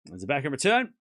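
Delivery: ordinary delivery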